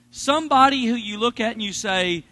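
ordinary talk

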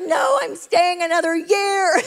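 high, slow retold declaration; defiant reply. To girls who thought she was gone, defiant reply. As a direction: declare it stubbornly.

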